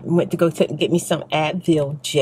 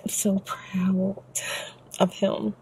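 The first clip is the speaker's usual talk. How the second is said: softer and slow, choked up with pride; getting emotional; 7 dB quieter, slower than usual. Real, not acted. A mom tells viewers she's proud of her son, choked up. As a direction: let the pride catch in your throat. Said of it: "I don't wanna get emotional".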